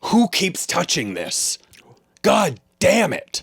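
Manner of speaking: high, slow, rising dad rant; angry dad. Retold or imitated. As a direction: rant like a cranky father. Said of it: "you hear a voice say".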